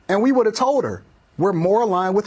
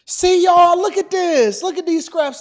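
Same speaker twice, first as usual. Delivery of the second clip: mock taunt; 7 semitones higher, 4 dB louder than usual. Mocking the other side.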